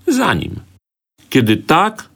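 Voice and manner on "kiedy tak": put-on high, plaintive little girl; on the verge of tears, lightly acted; half crying. A narrator's reading. Girl giving in to follow her brother, half crying. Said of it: "na wpół z płaczem zawołała Halszka".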